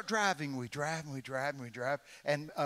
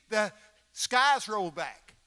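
normal talking voice

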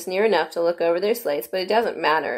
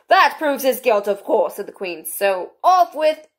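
loud, high queen; 6 semitones higher, 5 dB louder, slower than usual. A narrator's reading. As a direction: bellow like a bossy queen. Queen voiced.

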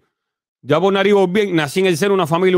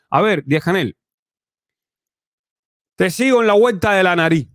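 ordinary talk

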